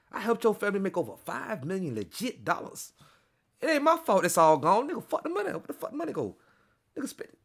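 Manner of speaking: high-pitched, indignant rapper imitation; hammed-up scolding in a high voice. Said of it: "I gotta read it in the fifty voice".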